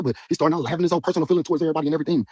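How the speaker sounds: ordinary talk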